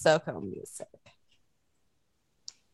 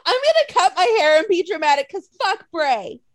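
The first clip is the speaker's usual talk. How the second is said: higher, louder, hammed-up vow; mock dramatic declaration; 5 semitones higher, 5 dB louder than usual. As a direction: announce it like a self-mocking drama queen. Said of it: "I was being dramatic".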